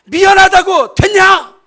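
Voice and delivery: an exasperated spouse yelling sorry; furious shouted sorry. Shown as a demonstration. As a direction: shout it like a fight. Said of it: "그래도 더 화가 나-, 안 풀리면".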